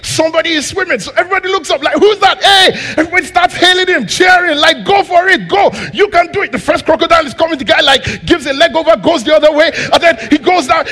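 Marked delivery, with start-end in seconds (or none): 2.0-2.7 high, loud startled shout; surprised shout. Acted. Crowd at the pool spotting a swimmer, shouting in surprise. 4.9-6.5 high, loud crowd cheer. Acted. Crowd at the pool cheering the swimmer, loud and high.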